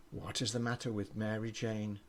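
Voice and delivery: ordinary delivery, nothing marked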